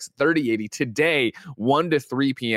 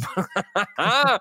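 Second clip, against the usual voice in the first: real laugh; 9 semitones higher than usual. Real, not acted. Laughing at a silly username.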